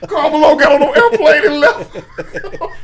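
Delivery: words through laughter; laughing. Real, not acted. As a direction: laugh as you tell it. Laughing while telling.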